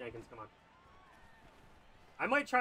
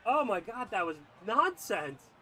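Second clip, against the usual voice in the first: normal talking voice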